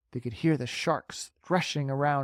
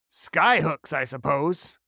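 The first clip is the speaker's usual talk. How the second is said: jeering; 3 semitones higher, 5 dB louder, slower than usual. Acted. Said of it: "jeered the centipede".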